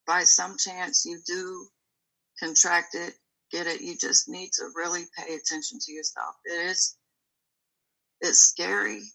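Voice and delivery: ordinary delivery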